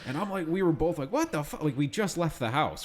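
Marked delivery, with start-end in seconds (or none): 1.1-1.6 shocked high exclamation. Retold or imitated. Both shocked by dog.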